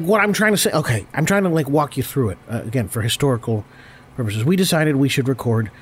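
normal talking voice

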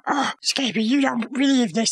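ordinary talk, nothing marked

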